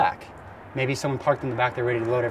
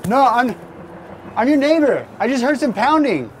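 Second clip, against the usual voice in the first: high, raised neighborly call; re-enacted shout to a stranger; 8 semitones higher than usual. Acting out calling to the stranger.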